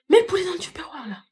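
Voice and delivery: whispered aside; whispering to her mother about the chicken. Acted. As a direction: hiss it under your breath so the guests won't hear.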